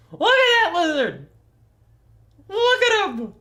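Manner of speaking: high loud squeal; overwhelmed by cuteness. Real, not acted. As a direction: shriek with delight at a pet. Gushing over lizard photos.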